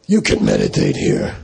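very low put-on lama voice; mean lama voice. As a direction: imitate a stern lama, dropped right down in pitch. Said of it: "His voice was like that".

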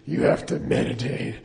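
imitation of a lama with a crushed voice box; Darth Vader. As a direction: order a pupil in a damaged, movie-villain voice. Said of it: "he talked like Darth Vader".

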